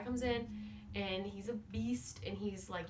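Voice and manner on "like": ordinary delivery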